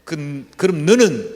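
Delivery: loud, slow, weighty question; God's question. Retold or imitated. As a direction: let each word land heavily. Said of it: "그 하나님의 질문". (무겁게 천천히 묻는 하나님)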